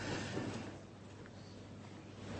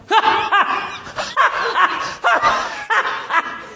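derisive laugh; 12 semitones higher than usual. Shown as a demonstration. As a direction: cackle scornfully at the fools. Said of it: "What's a derisive laugh?".

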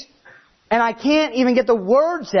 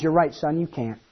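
dad voiced; 6 semitones lower, 5 dB quieter than usual. Dad voiced, deadpan punchline.

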